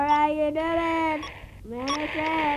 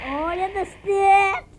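ordinary talk

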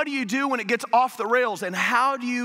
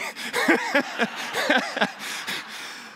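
breathy laugh; 10 semitones higher than usual. Real, not acted. Laugh after apologizing to valet.

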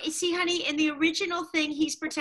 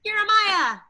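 loud high call of a name; 7 semitones higher, 6 dB louder, slower than usual. Real, not acted. Director calling a child actor onstage, loud. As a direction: shout the name to get attention.

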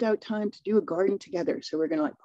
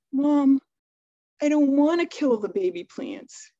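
put-on upset child; 6 semitones higher, 4 dB louder than usual. Daughter explains her refusal.

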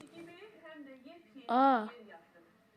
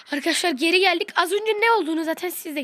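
excited; 6 semitones higher, 8 dB louder than usual. Real, not acted. Returning on stream, energetic.

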